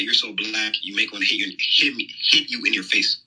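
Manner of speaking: ordinary delivery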